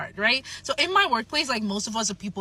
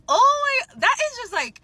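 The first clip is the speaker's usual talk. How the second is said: much higher and louder, stunned disbelief; shocked outburst; 13 semitones higher, 6 dB louder than usual. Real, not acted. Reacting to the customer walking out, shocked and loud. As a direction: blurt it out, floored by what just happened.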